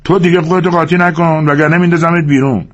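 a touch of a bullying snap; snapping. Acted. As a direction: snap a threat at him. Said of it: "به او تشر زد".

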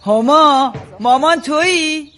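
loud call through the house. Acted. Mother calling to her daughter arriving home, loud call. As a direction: call out to check who has come in.

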